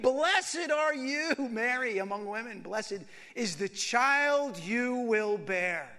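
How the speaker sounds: slow, loud and high proclamation; joyful loud blessing; loud exclamation. A narrator's reading. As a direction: proclaim it slowly across the room. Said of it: "And in a loud voice, she exclaimed".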